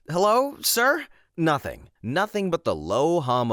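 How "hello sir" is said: sheepish check-in. Retold or imitated. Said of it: "pressed mute again and said".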